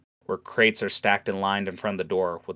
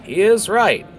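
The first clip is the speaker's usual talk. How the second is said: Heather voice; 5 semitones higher, 5 dB louder than usual. Acted. Heather concerned to Goran.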